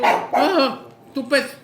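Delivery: excited outburst. Real, not acted. Excited outburst while eating.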